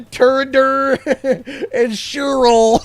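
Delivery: names dissolving into laughter; can't get the name out for laughing; laughing through the name. Real, not acted. Reading odd names to chat, breaking into laughter.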